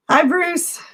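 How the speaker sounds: raspy lost voice; hoarse greeting, voice cracking; funny hoarse voice. Real, not acted. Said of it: "my funny voice".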